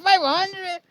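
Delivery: high amazed question on "Five hundred"; "Five hundred" thrown up high in disbelief. Retold or imitated. Friend hearing how many videos he has, amazed high repeat.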